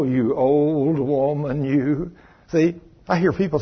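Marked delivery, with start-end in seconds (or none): 0.0-2.1 mock self-pity. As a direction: sigh it out like a complainer. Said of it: "people say".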